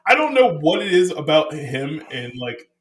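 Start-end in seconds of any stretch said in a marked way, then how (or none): none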